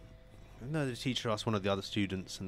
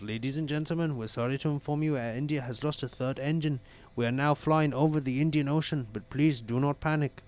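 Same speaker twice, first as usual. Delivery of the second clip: put-on higher-pitched Indian-accented cabin announcement; 5 semitones higher, 3 dB louder than usual. Airline announcer calming passengers after engine loss, Indian accent. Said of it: "excuse me for my Indian accent".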